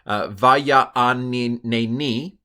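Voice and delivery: "vaya aneni" stretched very slowly with a rising end; slow Hebrew repeat. Shown as a demonstration. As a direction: savor the fun word as you sound it out. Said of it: "That's a fun word".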